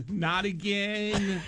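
slow woman's voice, dismayed; dismayed office lady. Pretend. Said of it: "from an office lady".

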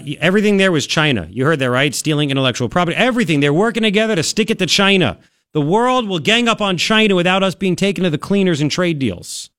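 ordinary talk, nothing marked

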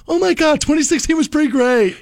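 mock gushing. Mocking cheerful year-end posts.

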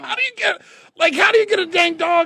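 very high, loud disbelieving cry; amazed high squeal. Retold or imitated. Retold own amazed reaction.

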